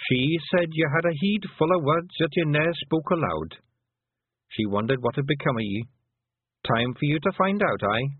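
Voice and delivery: put-on an old clan mother talking fondly of her lads; old Pictsie mother. Kelder speaks of Granny Aching.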